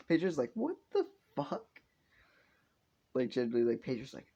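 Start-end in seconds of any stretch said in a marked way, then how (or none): 0.5-1.7 high, rising disbelief; hammed-up squeaky astonishment; mock shock. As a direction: let the pitch leap in acted bewilderment. Character's acted shock, repeated.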